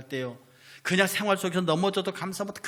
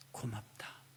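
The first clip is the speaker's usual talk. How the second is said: put-on hushed, lower thanks to a child; tender whisper; 6 semitones lower, 14 dB quieter, slower than usual. Parent to child, whispered tender thanks. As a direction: breathe the thanks like a secret blessing.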